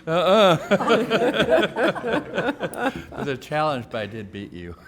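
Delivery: laughing and out of breath; winded, chuckling boast. Real, not acted. Winded after racing rival to mic.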